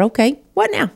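high, pushing challenge thrown upward; defiant challenge. Retold or imitated. To God after losing Kirk, a high defiant dare. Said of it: "And I remember telling God".

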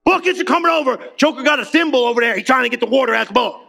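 ordinary talk

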